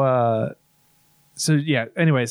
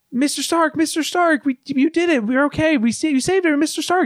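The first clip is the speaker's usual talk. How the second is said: put-on high, pleading teen voice; mock tearful boy begging his mentor; imitating crying Peter; 12 semitones higher than usual. Retelling Peter begging Tony to wake up, high and pleading. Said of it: "he's, like, crying".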